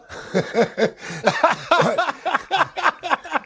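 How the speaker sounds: real high-pitched burst of laughter. Real, not acted. Host cracking up at Rahm's F-bomb, real high laugh. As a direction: crack up at the story. Laughing at his own retelling.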